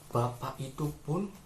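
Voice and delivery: usual speaking voice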